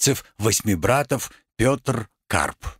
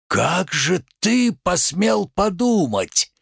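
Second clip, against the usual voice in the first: slow, theatrical indignation; indignado; 3 semitones higher, slower than usual. Acted. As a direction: draw out the outraged question like a tragedian. Indignant tragedian rebukes merchant.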